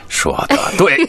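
ordinary talk, nothing marked

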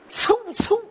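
sharp, high whoosh of two people dashing past; whooshing sound. Retold or imitated. Crosstalk comic imitating runners flashing past, sharp whoosh. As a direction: spit out a quick whoosh.

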